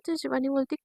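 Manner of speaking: ordinary delivery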